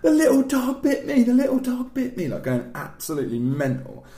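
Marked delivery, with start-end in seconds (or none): 0.0-2.3 high, loud mock wailing; shrill-pitched hammed-up bawl; bawling little sister. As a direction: imitate a hysterical child crying out. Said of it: "absolutely bawling her eyes out".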